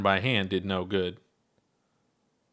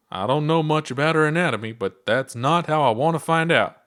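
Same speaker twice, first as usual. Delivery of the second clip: nervous; 5 semitones higher, 5 dB louder than usual. Acted. Ponder frightened inside the maw.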